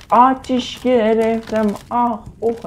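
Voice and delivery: slow, high hammed-up yelps of pain; mock burning cries. Gamer hamming up burning alive, high yelps. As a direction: ham up being on fire.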